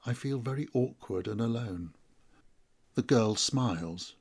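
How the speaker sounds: plain reading voice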